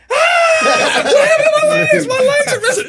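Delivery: very high, loud shriek through laughter; mock panicked scream. Drunk Marine pretending to discover his missing legs, mock panicked scream. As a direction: scream in fake horror, laughing.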